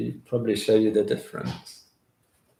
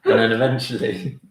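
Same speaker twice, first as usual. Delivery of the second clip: laughing; 5 dB louder than usual. Real, not acted. Laughing while echoing partner's line.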